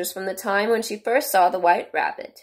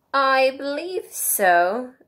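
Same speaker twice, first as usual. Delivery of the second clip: slow, drawn-out, higher-pitched reply; thoughtful; 7 semitones higher, much slower than usual. A narrator's reading. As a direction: ponder it, stretching each word. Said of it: "Alice replied thoughtfully".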